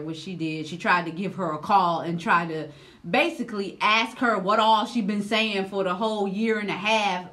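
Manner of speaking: ordinary delivery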